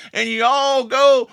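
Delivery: ordinary delivery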